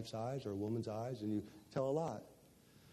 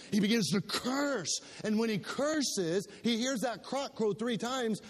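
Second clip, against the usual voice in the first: higher, driving sermon voice; preaching; 7 semitones higher than usual. Acted. Pastor telling the church about Peter, fervent preaching. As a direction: tell Peter's story with mounting passion.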